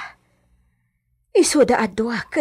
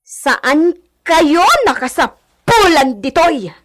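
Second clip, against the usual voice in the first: angry commands; 3 semitones higher, 5 dB louder than usual. Acted. Orders the servants off the hacienda.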